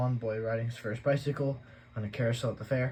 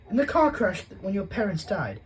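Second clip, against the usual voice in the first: higher, louder, curt answer; Aunt Petunia; 10 semitones higher, 8 dB louder than usual. A narrator's reading. Aunt answering the young boy, curt and high. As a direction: brush the question off. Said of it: "she said".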